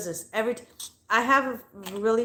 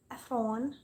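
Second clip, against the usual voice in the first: Efron with a foreign accent and a rising end; lightly accented Efron; a playful accent on Efron; name said with an accent; 2 semitones higher, 5 dB quieter than usual. Shown as a demonstration. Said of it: "I'm going to say his name with an accent".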